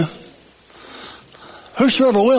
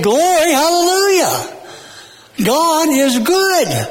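high, ringing hallelujah with long held words; exultant praise; 9 semitones higher than usual. Acted. Preacher claps hands and praises God, exultant high cry. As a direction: cry out praise, stretching each phrase. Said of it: "put my hands together and say".

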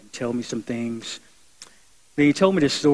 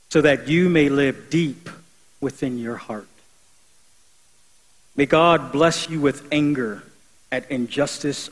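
building, slow, louder preached blessing; prayer reading; 3 semitones higher, 5 dB louder, slower than usual. Acted. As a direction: proclaim each blessing, rising in force. Reads the Franciscan blessing aloud.

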